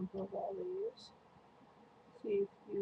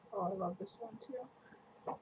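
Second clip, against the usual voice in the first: ordinary talk, nothing marked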